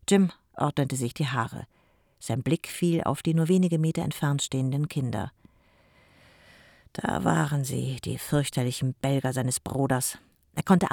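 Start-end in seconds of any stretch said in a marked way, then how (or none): none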